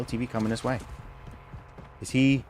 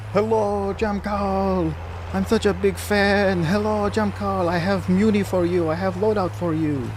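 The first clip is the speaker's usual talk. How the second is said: much higher, slow, put-on generic EU accent of a gushing fan; eager fan accent; 11 semitones higher, slower than usual. Streamer imagines a stream sniper greeting him, gushing high EU accent. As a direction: squeal like a starstruck European fan. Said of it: "He'd be, like, saying".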